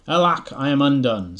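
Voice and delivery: hammed-up lament, loud and high; dramatic lament. A narrator's reading. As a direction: wail it like a ruined man.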